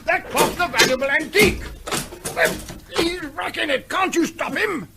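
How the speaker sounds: outraged shouting. Acted. Major yells in outrage as his antique clock is smashed. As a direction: yell at the brute to stop wrecking it.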